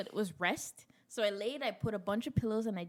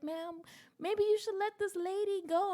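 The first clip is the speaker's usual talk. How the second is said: lightly voiced girl; 11 semitones higher than usual. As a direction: offer the spot kindly. Waiting girl offers her turn.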